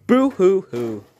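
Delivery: hammed-up slow fake crying; mock sobbing. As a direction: taunt the loser with fake sobs.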